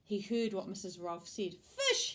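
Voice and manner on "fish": very high, loud yelp; shouting. A narrator's reading. Mister Rogers hears about the fish, shouting. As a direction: shout it in outrage. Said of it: "he shouted".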